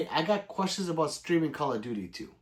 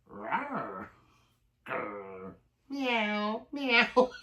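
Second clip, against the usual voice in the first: silly roar, growl and meow; playful animal noises; 5 semitones higher, much slower than usual. Pretend. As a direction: play the animals for chat.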